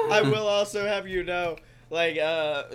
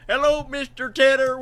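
Cosby voice; 7 semitones higher, 8 dB louder than usual. Pretend. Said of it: "One side says Cosby".

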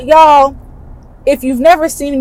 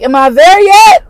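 called out high and loud from above; calling down nervously; 7 semitones higher, 6 dB louder than usual. Retold or imitated. Dangling high in the air, calling down to her husband, loud and nervous. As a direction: yell down to the ground, half joking. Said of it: "And I'm like".

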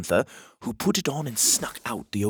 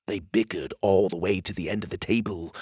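ordinary delivery, nothing marked

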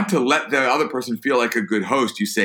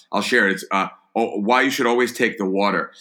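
ordinary talk, nothing marked

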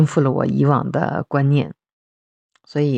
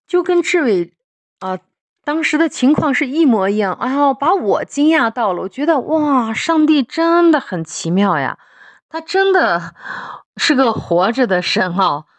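much higher, thrilled voice of amazement; amazed and excited; 8 semitones higher than usual. Real, not acted. Mother telling how the psalm matched her son, amazed. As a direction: voice leaps up in awe at a miracle.

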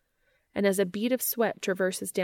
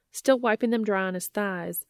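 plain reading voice

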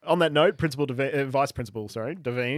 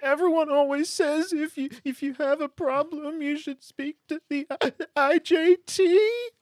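put-on crying boy; 13 semitones higher, 5 dB louder than usual. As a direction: wail it like a little kid who lost his bike. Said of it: "Crying in accent is hard".

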